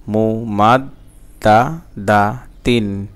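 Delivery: "মুমাদ্দাদাতিন" slowly, slightly spaced syllables; measured reading pronunciation. Shown as a demonstration. Teacher repeating the word for learners, slow and measured. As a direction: draw out "মুমাদ্দাদাতিন" carefully. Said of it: "reading উচ্চারণ".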